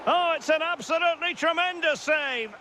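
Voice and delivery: high, amazed commentary; excited save call. Acted. Commentator reacting to a tremendous save, excited. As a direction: lift the pitch high for a big moment.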